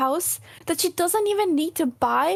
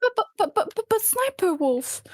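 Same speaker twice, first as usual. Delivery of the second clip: higher-pitched mock protest; 8 semitones higher than usual. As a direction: stammer a mocking objection in a raised voice. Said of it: "I'm just mocking her".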